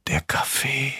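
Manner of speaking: weak, breathy whisper; hushed whisper as Barbara. A narrator's reading. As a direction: whisper it softly, with no voice. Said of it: "flüsterte Barbara".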